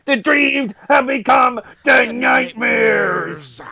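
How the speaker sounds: slow, high, hammed-up shout; drawn-out imitation promo yell; mock wrestling promo. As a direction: declaim it word by word like a wrestler.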